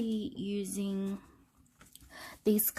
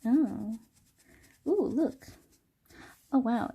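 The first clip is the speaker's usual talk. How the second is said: surprised; 2 semitones higher than usual. Real, not acted. Paint lifts off, unexpected result.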